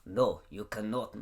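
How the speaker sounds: tentative big Russian guy; lightly imitated Russian accent. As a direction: attempt a blunt Russian refusal. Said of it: "how do you do a Russian accent".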